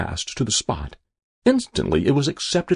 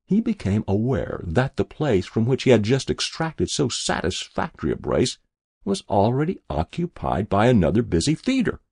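ordinary delivery, nothing marked

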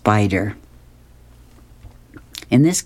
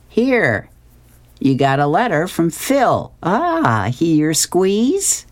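higher-pitched, teasing camp counselor; 6 semitones higher, slower than usual. Acted. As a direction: play the counselor, voice lifted. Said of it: "her counselor says".

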